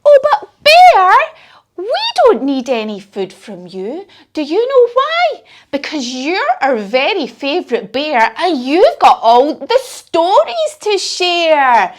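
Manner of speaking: high, bright little mouse voice that jumps up on questions; little Mouse reassuring Bear in a high squeaky voice. Acted. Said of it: "And Mouse says".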